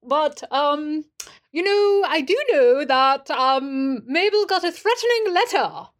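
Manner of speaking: put-on high, halting, overdone British accent; wobbly fake British accent. [name] hunts for his voice while reporting a letter, British accent. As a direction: restart until the stagey British voice catches. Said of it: "the accent, and it keeps evading him".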